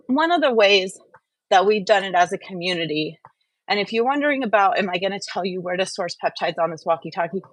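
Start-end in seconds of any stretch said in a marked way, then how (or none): none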